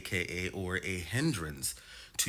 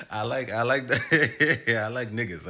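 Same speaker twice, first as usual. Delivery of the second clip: laughing; 5 semitones higher, 8 dB louder, slower than usual. Real, not acted. Giggling confession about liking men.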